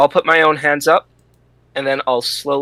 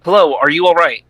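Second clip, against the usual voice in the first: calling out; 2 semitones higher, 6 dB louder than usual. Acted. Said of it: "I'll yell to him".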